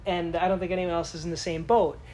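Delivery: ordinary talk, nothing marked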